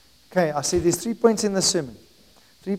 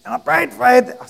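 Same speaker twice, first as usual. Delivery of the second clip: high, strained imitation of a sick voice; laryngitis voice; 7 semitones higher, 5 dB louder than usual. Preacher accidentally keeps the sick helper's voice, high laryngitis imitation. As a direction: slip into the imitated laryngitis voice, pitched up. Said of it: "sorry, and I prayed for Anthony".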